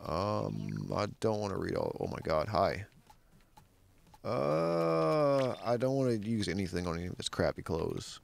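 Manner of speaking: normal talking voice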